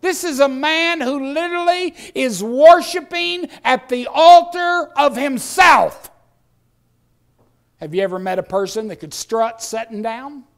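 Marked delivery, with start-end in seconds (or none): 0.0-6.1 loud, high, hammering each phrase; impassioned pulpit shouting; full-force preaching. Real, not acted.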